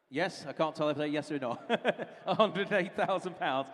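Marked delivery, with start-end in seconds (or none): 1.6-2.2 short laugh. Real, not acted. Can't tell bidder's yes or no. 2.2-3.7 laughing. Real, not acted. Laughing after unclear bidder.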